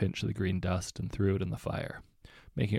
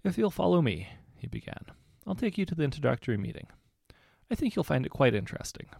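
put-on prim head boy; 6 semitones higher, faster than usual. A narrator's reading. Percy leads guests.

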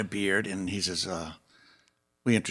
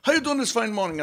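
imitating Paul; 13 semitones higher, faster than usual. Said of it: "first thing he said to me".

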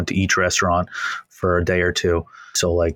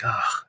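soft breathy exasperated ah; a whispered sigh of being overwhelmed; 3 semitones higher, 6 dB quieter than usual. Retold or imitated. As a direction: let out a hushed defeated ah. Exasperated reaction to staff.

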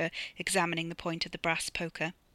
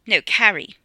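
loud correction; 3 semitones higher, 13 dB louder than usual. Acted. Correcting the name.

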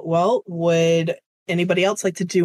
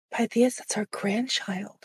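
hushed whisper to her husband; mother whispering to her husband about the grandchild; 4 semitones higher, 6 dB quieter than usual. Acted. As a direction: breathe it softly in awe.

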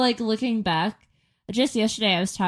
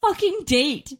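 indignant; 9 semitones higher, slower than usual. Real, not acted. Outraged recollection.

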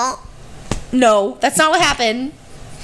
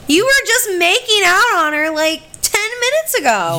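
indignant retort; 8 semitones higher, 3 dB louder than usual. Retold or imitated. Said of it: "I'm like".

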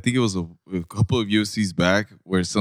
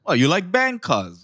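put-on Hawaiian pidgin accent; 8 semitones higher than usual. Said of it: "That Hawaiian in him".

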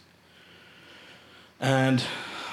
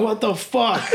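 high, laughing amazement; retold astonishment, pitched well up; can't believe it, tickled; stunned disbelief; 7 semitones higher than usual. Retells reaction to shout-out from stage.

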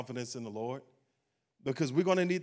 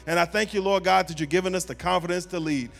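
fervent prayer building louder over music; preaching prayer; 5 dB louder than usual. Acted. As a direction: pray over the room with rising fervor. Leads closing prayer.

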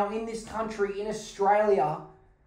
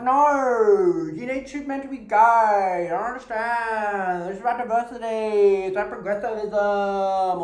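mock preachy activist; 3 dB louder than usual. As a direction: parody a self-righteous diversity preacher. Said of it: "people be like".

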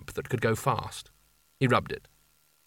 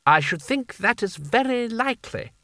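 high, prissy and prim sultan; 10 semitones higher than usual. Acted. The Seraph agreeing a rescue is needed, prim and high. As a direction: sniff it out primly, a touch superior. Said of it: "said Creosote primly".

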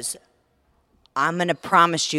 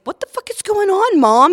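alarmed son; 10 dB louder than usual. Retold or imitated. Voicing her alarmed son.

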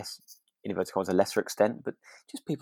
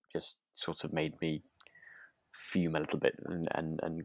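hushed, breathy confession of anger; a quiet, half-whispered seethe; whispered fuming; 3 semitones lower, 9 dB quieter than usual. Real, not acted. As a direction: whisper it as if admitting you're cross.

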